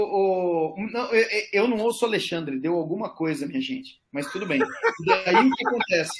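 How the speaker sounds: ordinary talk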